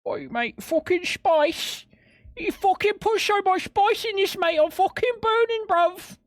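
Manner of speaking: hammed-up high-pitched British bloke; a British lad squealing that the spice is burning him; mock British accent. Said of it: "I didn't know you were British".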